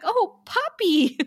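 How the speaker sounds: high, drawn-out coo; mock sympathy. Host imitating Anya hearing about the eaten dog, cooing. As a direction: coo it slowly in a put-on high voice.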